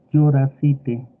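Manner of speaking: worried Pasto exclamation. Shown as a demonstration. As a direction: cry out "Lloracite" like a worried Pasto speaker. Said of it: "eso expresa que usted está muy preocupado".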